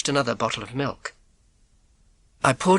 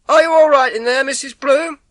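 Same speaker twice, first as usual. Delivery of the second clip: high, louder call through the door; milkman calling; 13 semitones higher, 8 dB louder than usual. Acted. Milkman calling through the front door, loud and concerned. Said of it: "I knew his voice".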